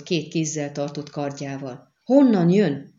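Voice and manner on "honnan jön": loud, raised cry of alarm; rikoltás. A narrator's reading. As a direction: cry out, searching for the threat. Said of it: "rikoltott fel Boreas Mun". (riadt, hangos kiáltás)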